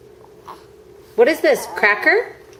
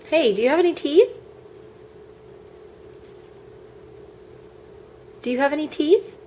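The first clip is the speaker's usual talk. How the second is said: put-on baby talk; 6 semitones higher, 5 dB quieter, faster than usual.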